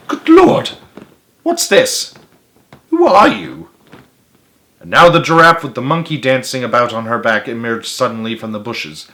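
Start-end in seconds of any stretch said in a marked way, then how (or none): 0.1-3.7 high, startled exclamations; astonished gasp. A narrator's reading. Duke astonished by boy in pelican, high startled gasp. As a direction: blurt it out, utterly staggered. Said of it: "he gasped".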